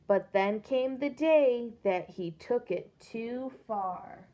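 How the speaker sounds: lively, loud read-aloud storytelling; reading to children. A narrator's reading. Teacher reads the pig book aloud to her class, animated storytelling.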